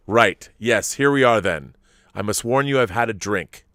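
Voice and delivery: ordinary talk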